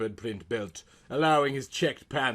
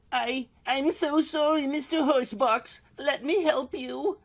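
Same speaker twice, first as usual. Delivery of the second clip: much higher, pleading put-on voice; begging; 4 dB louder than usual. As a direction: beg him in a high flustered voice. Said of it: "she begged".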